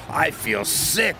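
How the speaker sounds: slow, high sickly wail. A narrator's reading. Diesel breaking down, sick wail. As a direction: moan that you feel ill. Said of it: "wailed Diesel".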